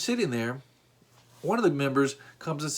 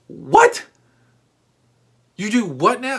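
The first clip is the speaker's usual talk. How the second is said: What? shrieked far higher and louder; stunned disbelief; 9 semitones higher, 7 dB louder than usual. Real, not acted. Hearing Steve goes to church with the flu, a shocked high shriek. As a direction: explode in disbelief, then press him.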